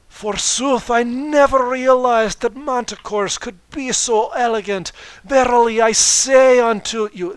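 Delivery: put-on Scottish accent, loud and high, archaic grandeur; Scottish royal declaring forsooth; loud high Scottish-accented queen; Scottish Luna. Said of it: "said Luna scottishly".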